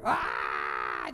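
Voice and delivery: low drawn-out mock scream; a long mock scream that drops; imitating her longer scream; imitated scream. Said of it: "Louder and longer".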